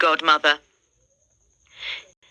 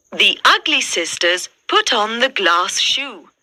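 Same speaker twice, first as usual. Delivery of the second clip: ordinary delivery, nothing marked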